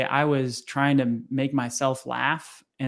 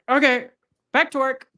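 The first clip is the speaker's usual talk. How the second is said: high, louder, bright-eyed recovery; sudden perky resolve; 10 semitones higher, 4 dB louder than usual. Retold or imitated. Young salesman back to work after crying, mock perky. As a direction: snap out of it cheerfully. Watch ends cry, instantly resumes work.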